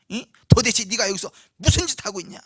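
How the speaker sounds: loud acted outburst; angry scolding. Eldest brother demanding an answer from David, acted angry scolding. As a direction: snap at the younger brother. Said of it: "막 화를 내고 막 분통을 터지면서".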